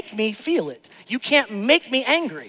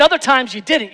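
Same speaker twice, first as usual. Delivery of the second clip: ordinary talk, nothing marked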